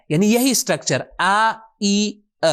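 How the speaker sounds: ordinary talk, nothing marked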